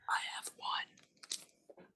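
slow, hushed whisper. Real, not acted. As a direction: whisper it like a secret. Quiet confession about owning guns.

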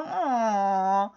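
long, drawn-out sympathetic "aww"; mock studio audience aww; imitated audience aww. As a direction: coo a crowd's aww, low and soft. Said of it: "you hear the audience go".

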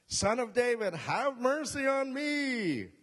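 high, slow, drawn-out plea; pleading shout. Acted. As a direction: stretch the plea out over the crowd. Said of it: "Pretty good, huh?".

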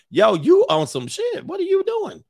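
high, squeaking mock disbelief; hammed-up bewildered outcry; alarmed protest. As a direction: protest in comic alarm, voice shooting up. Acted alarmed man protesting.

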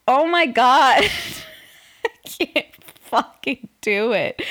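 loud frustrated groan; exasperated outburst. Real, not acted. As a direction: burst out in frustration.

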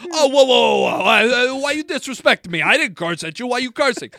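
loud, high, wounded protest in imitation; indignant Jersey guy. Imitating the roommate complaining about being cursed at, indignant Jersey guy. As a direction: mimic an old-school Jersey dude taking offence. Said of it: "like, old school Italian, like, Jersey dude".

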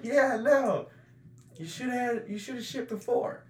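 high-pitched mock holler; shouting across party. Dad answers Dickie across the party, calling out. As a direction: imitate calling back over a crowd. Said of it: "Why are they shouting across the party?".

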